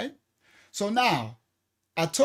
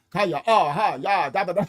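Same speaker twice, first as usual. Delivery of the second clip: ordinary delivery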